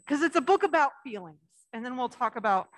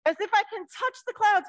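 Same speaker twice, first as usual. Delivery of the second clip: put-on reading to children; 7 semitones higher, 3 dB louder than usual. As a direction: draw the little ones into the story. Reads picture book to children.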